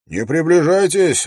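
loud, high warning shout; alarmed exclamation. A narrator's reading. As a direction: call out sharply to keep them back. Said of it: "воскликнул часовой". (громкий предостерегающий окрик часового)

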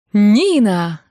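goofy yell. A narrator's reading. Groom clowning under the bride's window, goofy yelling. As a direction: holler it playfully, stretching the name. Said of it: "орал Келла дурашливым голосом".

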